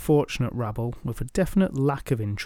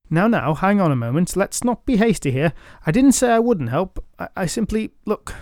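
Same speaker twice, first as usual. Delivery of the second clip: higher, hurried backpedal; flustered stutter; 6 semitones higher than usual. Acted. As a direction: stumble over the words. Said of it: "he stuttered to a stop".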